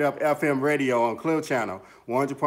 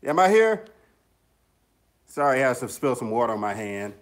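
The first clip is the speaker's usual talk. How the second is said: ordinary talk, nothing marked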